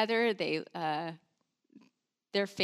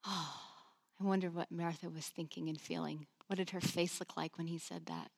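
hushed wondering; 3 semitones lower, 11 dB quieter than usual. Real, not acted. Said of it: "reflective hushed aside".

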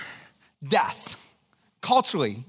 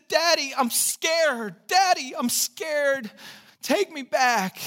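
put-on mild scared child; 6 semitones higher, slower than usual. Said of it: "And she said".

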